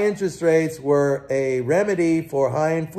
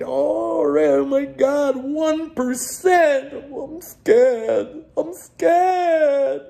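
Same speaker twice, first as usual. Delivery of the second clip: high, whimpering mock terror; mock panicked investor; 11 semitones higher, much slower than usual. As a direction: squeal like a scared investor about to cry. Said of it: "Everybody panicked".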